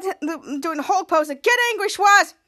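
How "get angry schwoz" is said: loud, higher call to a fighter; retold urging yell. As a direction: imitate a spectator egging him on.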